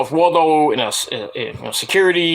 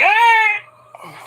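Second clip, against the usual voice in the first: high drawn-out mock yell; sudden high-pitched "ah"; imitated referee yell. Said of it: "Paul Turner was like".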